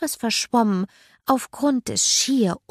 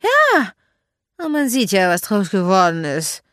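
dry retort; 7 dB louder than usual. A narrator's reading. Magda answering Mark on boarding school, dry retort. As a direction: retort pointedly, falling in pitch. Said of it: "entgegnete Magda trocken".

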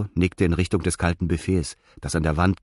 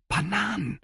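trembling voice; 14 semitones higher, 5 dB quieter than usual. A narrator's reading. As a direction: voice shaking with longing, high. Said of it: "Etzos Stimme zitterte". (zitternde Stimme, hoch)

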